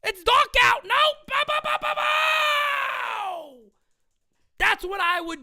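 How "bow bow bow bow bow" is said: high, shouted bow bow gun noises; imitated gunshots. Host joking about ending it, shouting mock gunshots. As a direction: fire off mock gunshots with your mouth.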